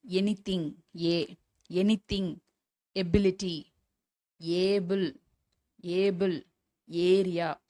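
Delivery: "anything", "able", "area" each opening on "ye/ay"; ஏ sound demo. Shown as a demonstration. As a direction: teach "anything", "ability", "able", "area" word by word with an "ay" start. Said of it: "இது எல்லாமே ஏ வச்சு வரும்". ("anything", "able", "area" ஏ ஒலியில் தொடங்கி உச்சரி)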